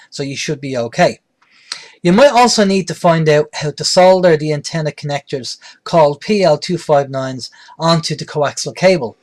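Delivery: normal talking voice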